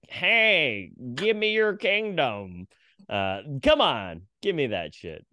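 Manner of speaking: slow, stretched-out amateur impression of a film director; David Lynch. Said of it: "That was not my best David Lynch".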